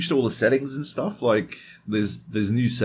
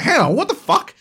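much higher, stunned protest; shocked outrage; 5 dB louder than usual. Retold or imitated. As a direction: caught off guard, indignant. Shocked at being voted.

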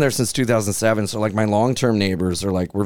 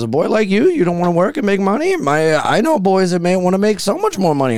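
higher-pitched creepy recruiter voice, lightly done; mock creepy man; 8 semitones higher than usual. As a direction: play the pushy stranger in a raised voice. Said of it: "And he goes".

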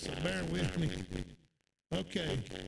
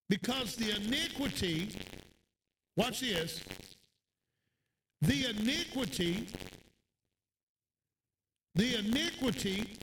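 preaching; 3 semitones higher than usual. Acted. Preaching the scripture.

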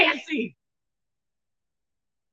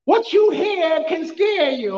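ordinary talk, nothing marked

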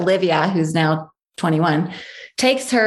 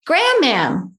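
put-on toddler answering; 7 semitones higher than usual. Toddler voiced naming grandmother.

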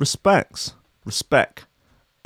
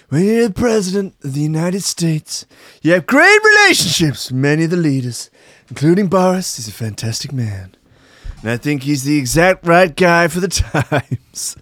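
Trump impression; 4 semitones higher than usual. Quoting Trump to Farage, higher boastful Trump voice. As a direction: puff up and brag like Trump. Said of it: "channeling Donny T".

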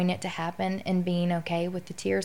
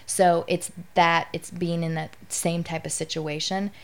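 normal talking voice

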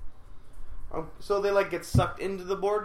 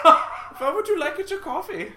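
mock German barista; 11 semitones higher, 6 dB louder than usual. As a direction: ask it like a cartoon German barista.

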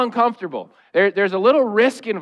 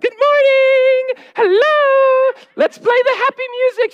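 put-on much higher, louder, slow singsong child voice; happy little daughter; 5 dB louder, slower than usual. Father imitating his happy daughter waking the family, high singsong. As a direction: chirp it out like a cheery nine-year-old. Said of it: "And Sage is like".

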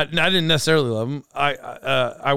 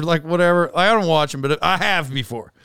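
normal talking voice

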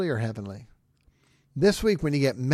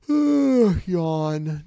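mock yawn with sigh; 6 semitones higher, 8 dB louder than usual. Mock bored reaction.

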